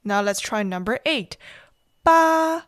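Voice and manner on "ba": Ba held high and level; first tone. Shown as a demonstration. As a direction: keep Ba flat and high. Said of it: "Number eight is first tone".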